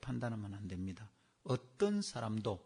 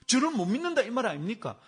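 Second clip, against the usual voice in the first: higher, louder indignant protest; retold angry protest; 10 semitones higher, 11 dB louder than usual. Deacon confronting his pastor, higher and louder in indignation. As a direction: play the offended deacon demanding to be believed. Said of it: "화를 내는 거예요".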